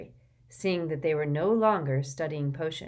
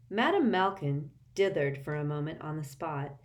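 ordinary delivery

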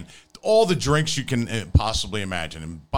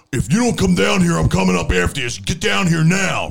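loud, raised biker threat; 8 semitones higher, 6 dB louder than usual. Retold or imitated. Uncle threatening to come upstairs after them, biker shout. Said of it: "this guy's voice was like, he sounded like a biker".

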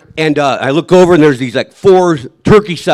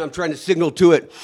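ordinary delivery

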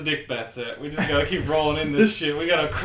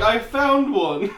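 high, slow, loud weary reveal; exasperated; 8 semitones higher, 6 dB louder, much slower than usual. Retold or imitated. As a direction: drag it out in disbelief. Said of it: "you're like".

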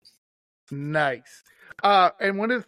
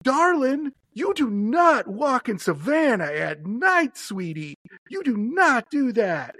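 lightly voiced Southern lady, higher and slow; a hint of a sweet Southern matron warning; older Southern woman; 6 semitones higher, slower than usual. Host imitating the hotel woman warning about night walks, lightly voiced Southern lady. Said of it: "this really nice older Southern woman goes".

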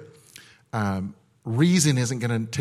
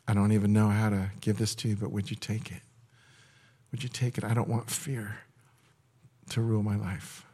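hushed led prayer; guided prayer; 2 semitones lower, 4 dB quieter than usual. Acted. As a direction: pray it quietly on their behalf. Leads surrender prayer.

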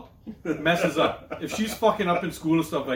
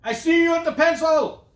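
loud, high-pitched yell from across the way; 13 semitones higher, 7 dB louder than usual. Acted. Dad calling out his daughter at school drop-off, yelling. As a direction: call it out at full volume. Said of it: "I should yell it from over".

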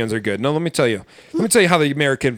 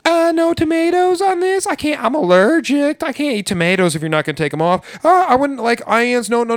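much higher, whiny mock customer; mock the fussy diners with a whiny high voice; picky American customer; 7 semitones higher than usual. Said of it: "you get a goddamn table of Americans".

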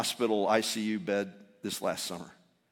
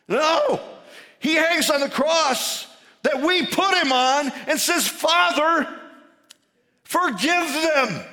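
loud, high, driving sermon delivery; full-force preaching; 12 semitones higher, 7 dB louder than usual. Acted. Preacher tells congregation Jesus forgives from the cross, preaching loudly. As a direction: preach it out with raised pitch and volume.

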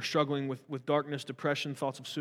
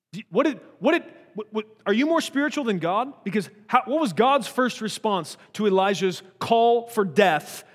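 higher, louder incredulous push; indignant challenge; 6 semitones higher, 6 dB louder than usual. Real, not acted. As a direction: challenge them with rising heat. Challenging hyper-spiritual people.

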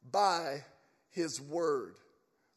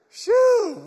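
high, loud, drawn-out whew; mock stench "whew"; 13 dB louder than usual. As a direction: blow out a big, high "shoo" at the stink. Acts Lazarus reacting to stench.

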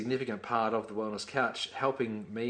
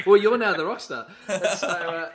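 much higher and louder, laughing through the words; 7 semitones higher, 8 dB louder than usual. Real, not acted. Co-host laughs teasing his partner, higher and louder. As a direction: crack up while handing over the title.

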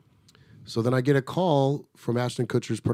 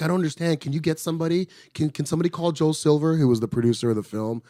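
imitating her panic, higher and rising; mock flustered plea, pitched up; retold freaking out; 7 semitones higher, 4 dB louder than usual. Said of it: "she started freaking out".

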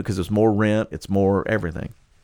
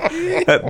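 high laugh. Real, not acted. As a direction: laugh again lightly. Keeps laughing at John.

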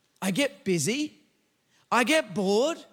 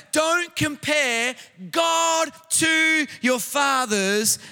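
drawn-out shouted plea, slow; urgent preaching shout; 3 semitones higher, much slower than usual. Real, not acted.

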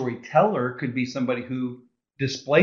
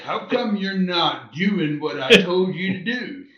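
high, slow mock manager; slow high-pitched boss impression; 3 semitones higher, 5 dB louder, slower than usual. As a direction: drag it out like a dim boss. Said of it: "what kind of a boss did you have that talked like that".

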